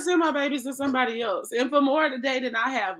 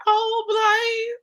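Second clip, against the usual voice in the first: high, drawn-out pretend wail; mock crying; 11 semitones higher, 3 dB louder than usual. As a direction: tease a friend by wailing for the child. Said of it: "Just crying on my pillow".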